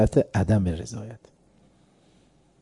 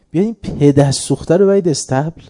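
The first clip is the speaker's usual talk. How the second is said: retold royal yell, louder and a touch higher; shah's angry shout; 3 semitones higher, 6 dB louder than usual. The shah shouting at his guards to drag the poet off, angry retold yell. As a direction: bellow the order at the guards like an offended king. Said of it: "یه دفعه یه فریاد زد".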